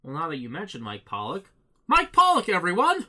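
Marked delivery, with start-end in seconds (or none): 1.9-3.1 loud, high showman's announcement; announcer introduction. Pretend. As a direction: introduce a star to the crowd at full volume. Announcing the next actor like a host.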